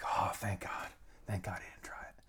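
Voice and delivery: breathy whispered relief under the breath; relieved whisper. Retold or imitated. Watching Robbie stop short, whispering thank God in relief. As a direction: whisper it to yourself, so relieved he stopped.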